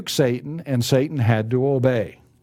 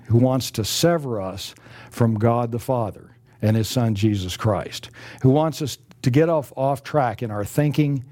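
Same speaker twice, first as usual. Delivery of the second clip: ordinary talk, nothing marked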